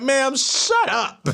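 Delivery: high, slowed mock-exasperated outburst; comic exasperation, breaking into a laugh; losing composure. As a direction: act out snapping at a psychic, drawn out. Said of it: "that's when I lost all that".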